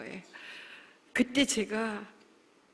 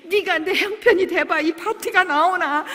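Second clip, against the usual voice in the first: bitter sob-laugh; 7 semitones higher, 7 dB louder than usual. Retold or imitated. Bitter complaint about the party.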